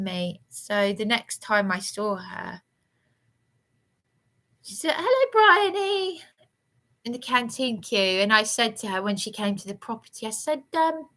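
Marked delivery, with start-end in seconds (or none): 5.0-6.2 high, sing-song fake hello; mocking a two-faced greeting, pitched high; mock sweet greeting. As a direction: imitate a falsely friendly neighbour. Mimicking Dawn's fake greeting.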